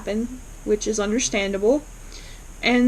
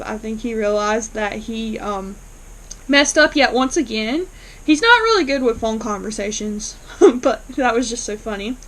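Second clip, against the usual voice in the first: ordinary talk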